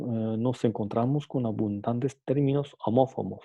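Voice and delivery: ordinary talk, nothing marked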